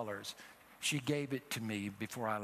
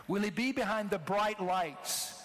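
preached question; 7 semitones higher, 4 dB louder, slower than usual. Real, not acted.

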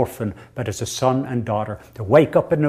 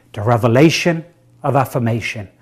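usual speaking voice

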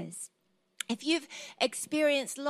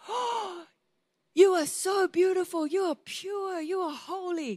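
adoring suitor; 7 semitones higher, 3 dB louder than usual. Retold or imitated. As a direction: gush in a raised voice like a smitten suitor. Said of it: "he begins to tell her".

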